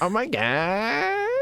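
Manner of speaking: high, drawn-out mock fangirl gush; exaggerated valley-style squeal. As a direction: stretch it out in fangirl parody. Copying the fangirl exclamation.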